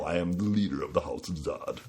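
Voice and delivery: grand, commanding villain proclamation; booming villain voice. Pretend. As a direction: declare it like a tyrant lord. Acting as clan leader Zod.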